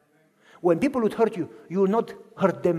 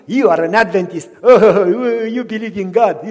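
louder, taunting schoolyard jeer; mocking classmates; 8 dB louder than usual. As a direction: tease like a first grader's bully.

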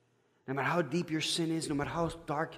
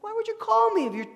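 baffled; 9 dB louder than usual. Retold or imitated.

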